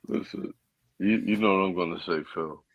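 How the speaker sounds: usual speaking voice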